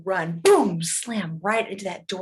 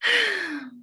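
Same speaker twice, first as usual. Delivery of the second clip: high sigh sliding down; long exasperated exhale; patient, put-upon sigh; 10 semitones higher than usual. A narrator's reading. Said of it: "She sighed again".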